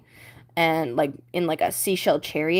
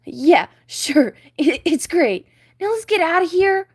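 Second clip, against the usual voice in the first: higher, louder nervous fish; nervous Flounder; 8 semitones higher, 6 dB louder than usual. Acted. Flounder nervously wanting to leave, higher voice. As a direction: brush it off nervously.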